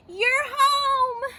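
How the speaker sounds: high, loud joyful greeting; delighted shout. Acted. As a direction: shout a big welcome. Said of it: "Goldie shouted".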